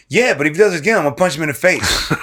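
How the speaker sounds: high, loud, half-laughing threat; laughing mock threat. Son jokingly tells dad he'll punch John, half-laughing. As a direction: joke about punching him, grinning.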